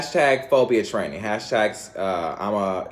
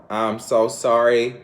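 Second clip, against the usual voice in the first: usual speaking voice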